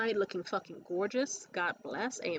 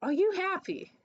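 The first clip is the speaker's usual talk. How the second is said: put-on high, louder drunk question; drunken slip; 10 semitones higher, 4 dB louder than usual. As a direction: blurt it out tipsy and too high. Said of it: "I was so drunk that I accidentally asked".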